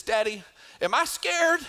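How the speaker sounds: put-on high, light child's question; hopeful little voice; little boy asking. Three-year-old asking his dad, light child voice. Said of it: "he says".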